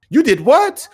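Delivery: loud, high, celebratory disbelief; excited amazement. Retold or imitated. Dad celebrating son's success, loud excited amazement. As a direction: exclaim in proud mock astonishment, pitch shooting up.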